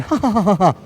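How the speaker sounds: mock laughter. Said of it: "we all started going".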